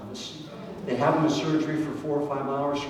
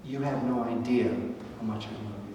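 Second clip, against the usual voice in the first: God speaking tenderly, softer; 3 semitones lower, 7 dB quieter than usual. Acted. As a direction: let each word land gently. Preacher voicing God's love.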